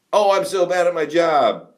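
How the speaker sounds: hammed-up server joke; mock self-deprecating server. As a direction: imitate a server fishing for laughs. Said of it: "trying to be funny".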